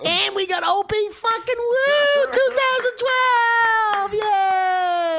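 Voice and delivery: mock excited fan. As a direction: squeal like a thrilled listener. Mock excited listener hearing the podcast.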